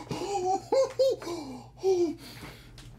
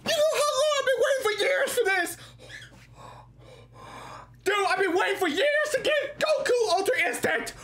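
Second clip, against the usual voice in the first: excited laughing shout; 3 semitones higher, faster than usual. Real, not acted. Finally pulls the rare character.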